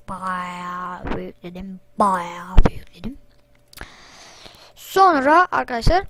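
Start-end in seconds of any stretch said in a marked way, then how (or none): 0.1-1.8 drawn out low and slow, Bayağı stretched; slow low drawl. Shown as a demonstration. To viewers about installing, slow low drawl. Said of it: "repeated in another voice". 2.0-3.2 Bayağı bir yükledim whispered, breathy and slow. Shown as a demonstration. To viewers about installing, a slow whisper. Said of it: "repeated in another voice".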